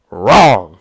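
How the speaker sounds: a sudden loud flat Wrong; one loud blunt word cutting off the hype; emphatic loud verdict. Real, not acted. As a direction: slam the door on the idea, loud. Pundit dismissing hyped quarterback.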